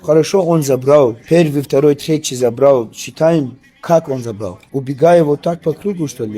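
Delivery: loud, heated complaint; indignant. Real, not acted. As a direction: push back loudly against the decision. Fighter disputes the judges' decision.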